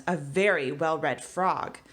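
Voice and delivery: ordinary talk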